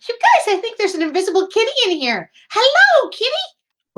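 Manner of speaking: loud, high-pitched delight; squealing up high to a kitty; excited kitty greeting. Acted. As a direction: call to a cat in a high voice. Excitedly greets an invisible cat.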